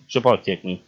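normal talking voice

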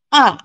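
short "A" falling sharply from high; fourth tone. Shown as a demonstration. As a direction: drop "A" quickly from the top. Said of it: "fourth tone".

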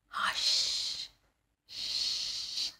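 soft breathy shush; whisper. Acted. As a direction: hush them gently to sleep. Bedtime hush in the story.

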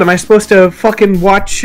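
ordinary delivery

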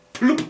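laughing burst and a popping sound; amused laugh, then pop. Real, not acted. Vlogger jokes his blocked nose popped free, laughing pop. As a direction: laugh and go plupp like a freed nose. Nose suddenly clear after tests.

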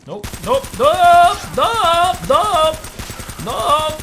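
high, loud panicked nope chant. Real, not acted. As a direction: shriek nope as you flee. Fleeing a scare.

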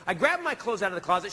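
usual speaking voice

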